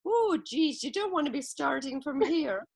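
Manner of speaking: put-on high, lilting Irish accent; mock Irish farmer with a lift. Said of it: "wonderful Irish accent went".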